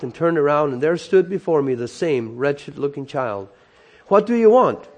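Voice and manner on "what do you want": higher, louder, sharp challenge; sharp question. A narrator's reading. Governor to the child following him, sharply. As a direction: ask it sharply, pitched up. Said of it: "I asked sharply".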